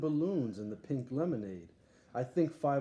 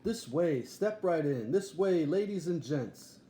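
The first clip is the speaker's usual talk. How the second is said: loud, higher barker's call to the crowd; circus barker; 4 semitones higher, 3 dB louder than usual. Acted. Morris McGurk calling the crowd in, as a loud circus barker. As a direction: hawk it like a ringmaster pulling people in.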